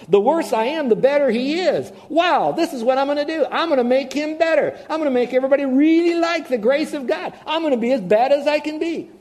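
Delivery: high, loud, eager sinner scheming to be bad; gleeful sinner. Preacher voicing a foolish sinner to his congregation, loud and gleeful.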